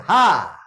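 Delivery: short demo laugh; one bright forced ha. Shown as a demonstration. Lecturer showing the audience how to laugh, a single ha. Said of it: "한번 저를 따라해보세요".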